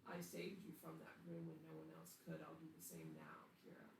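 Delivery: very soft, sinking low at the end; a possessing entity speaking in an undertone; otherworldly voice. Acted. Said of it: "the voice says".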